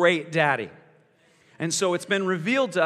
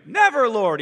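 louder, higher mock rebuke; rebuking; 4 semitones higher, 8 dB louder than usual. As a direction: burst out in protest. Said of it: "he begins to rebuke him".

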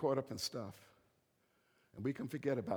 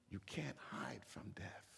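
slow, hushed whisper; solemn whisper; 9 dB quieter, slower than usual. Acted. Preacher warning the congregation about death, slow whisper. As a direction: let it sink in softly. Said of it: "sermon point delivered whispered".

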